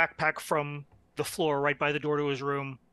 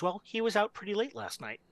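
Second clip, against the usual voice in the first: ordinary delivery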